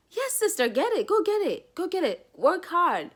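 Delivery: high, excited hype for a sister; cheering friend. Pretend. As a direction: cheer her on like her biggest fan.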